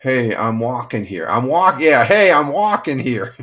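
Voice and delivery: put-on loud, drawn-out New York City movie accent; hammed-up New York street holler. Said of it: "it might be the New York City accent".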